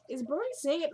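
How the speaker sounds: high-pitched mocking echo of her question; mock girlish inquiry. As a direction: parrot her in a lifted voice. Mimics Annie's question mockingly.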